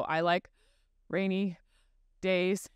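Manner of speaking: "I like", pause, "rainy", pause, "days" with breaths between; halting, disjointed delivery of "I like rainy days"; choppy phrasing. Shown as a demonstration. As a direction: break "I like rainy days" into separate chunks with long pauses. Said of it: "breathe where I'm not really meant to".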